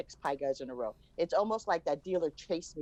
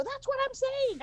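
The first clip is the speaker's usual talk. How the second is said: excited squeal; 3 dB louder than usual. Retold or imitated. Retelling reaction to son.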